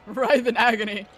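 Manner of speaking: laughing. Real, not acted. Laughing while joking.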